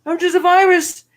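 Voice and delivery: high, pleading little virus voice; cute germ voice. Pretend. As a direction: play an innocent tiny virus begging. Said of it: "The poor little virus is like".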